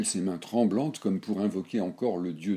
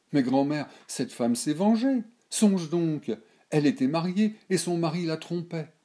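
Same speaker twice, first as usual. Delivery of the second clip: lightly voiced girl, pitched higher; a granddaughter pleading, a touch higher; young girl Berthe; 11 semitones higher, 6 dB louder than usual. A narrator's reading.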